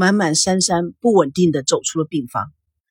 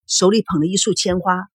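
plain reading voice

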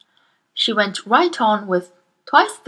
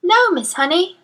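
ordinary delivery, nothing marked

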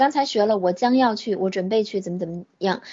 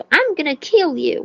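higher-pitched mock threat; mock violent threat; 5 semitones higher than usual. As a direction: imitate a TV-drama threat, lightly. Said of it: "特别暴力的那种".

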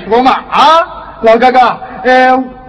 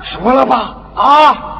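ordinary talk

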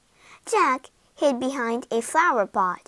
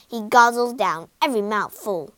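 plain reading voice

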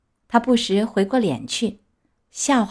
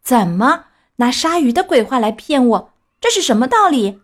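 huffy, louder indignant demand; angry; 4 semitones higher, 6 dB louder than usual. Acted. Tricked Pinocchio angrily confronts his classmates, louder. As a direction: fume at the tricksters. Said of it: "气呼呼地说".

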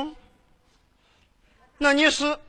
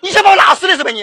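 indignant scolding; 6 semitones higher, 7 dB louder, faster than usual. Acted. Scolding the nephew.

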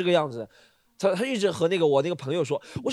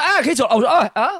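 raised, higher voice, acting out a noisy entrance; loud interruption; 6 semitones higher, 7 dB louder, faster than usual. Pretend boyfriend walking in on the pair, loudly. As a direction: burst in loudly with mock surprise. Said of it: "我一开始说话很大声".

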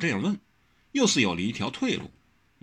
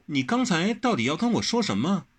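put-on higher, curious girl; Wen Rou asking; 9 semitones higher than usual. Wen Rou questioning Wang Xiaoshi, higher. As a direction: demand to know.

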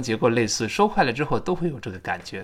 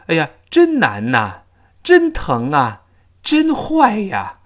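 louder, drawn-out 难呐 疼啊 坏呀; linked particles; 3 semitones higher, 5 dB louder, slower than usual. Shown as a demonstration. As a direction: stretch each particle, linked to its word. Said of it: "比如说我们说". (拖长并连读“呐”“啊”“呀”)

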